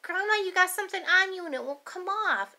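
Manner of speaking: lightly voiced worried child. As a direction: kid reporting a stain. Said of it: "She says".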